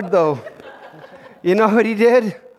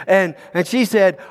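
ordinary delivery

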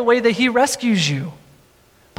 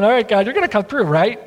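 quick aside, questioning lift on "right"; unsure Moses; faster than usual. Acted. Moses checking that God will come through, hurried and unsure. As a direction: rush it out, half-joking nervous check. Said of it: "parts of Moses going".

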